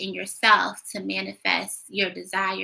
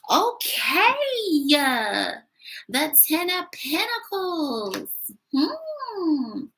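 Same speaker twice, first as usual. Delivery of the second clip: surprised; 7 semitones higher, much slower than usual. Real, not acted. Surprised at the drawn card.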